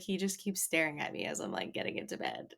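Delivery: fast, hushed aside fading to a whisper; mock flustered whisper. [name] voiced, flustered by staring.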